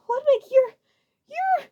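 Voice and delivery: high, loud stammer; shocked halting cry. A narrator's reading. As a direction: point and stutter in horror. Shaking finger, disbelief.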